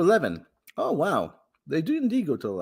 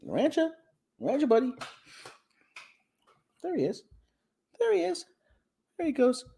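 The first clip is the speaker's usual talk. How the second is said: pet talk. Real, not acted. Calling and cooing to the cat.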